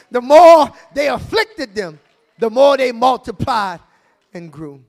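preached repeat. Shown as a demonstration. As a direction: drive the verse home. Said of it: "The Bible says in Exodus".

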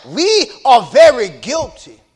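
slow, loud proclamation of the verse; shouted preaching. Acted. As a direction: hammer out every word to the church.

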